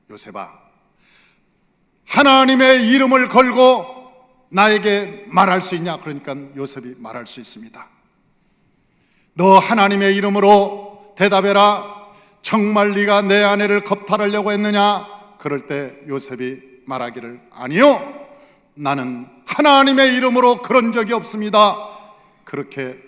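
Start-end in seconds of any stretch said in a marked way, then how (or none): 0.1-6.0 stern demand. Retold or imitated. Potiphar interrogates Joseph. 9.4-15.1 Potiphar presses Joseph in a loud stern voice; stern loud demand. Retold or imitated. Angry master questioning Joseph, loud and stern. 17.5-21.8 raised voice, swearing innocence before God; firm loud denial. Retold or imitated. Joseph swearing to his master he is innocent, loud and firm. Joseph denies under oath.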